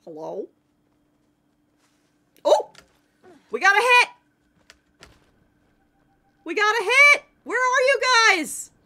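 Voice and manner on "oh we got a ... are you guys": high, loud urgent cry; excited shouting. Real, not acted. Hunter who got a hit calling the others, excited shout. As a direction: shout it out to scattered teammates. Hit during hunt, calls teammates.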